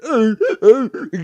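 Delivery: sudden high, loud laugh; loud burst of laughter. Real, not acted. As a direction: break into a loud mocking laugh. Scoffing at legal name fuss.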